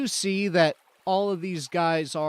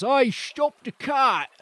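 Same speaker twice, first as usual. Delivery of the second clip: slow, loud Cockney bandit calling out "Oi, stop the cart!"; a rough Cockney hail to halt the cart; Cockney accent; 8 semitones higher, 7 dB louder, slower than usual. Acted. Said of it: "we're going for that accent this time".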